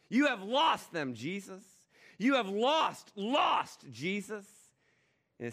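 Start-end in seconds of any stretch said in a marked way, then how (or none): 0.1-1.6 higher, louder gloating taunt; gloating Satan. Acted. Satan taunts Jesus that humanity is lost, gloating higher. 2.2-4.5 higher and louder, hammering the word lost; shrill Satan taunt. Acted. Satan jeers at Jesus, pushing the taunt higher.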